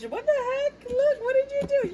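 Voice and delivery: high astonished laugh-cry; squeaky disbelief. Real, not acted. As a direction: squeal in amused shock.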